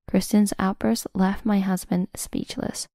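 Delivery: usual speaking voice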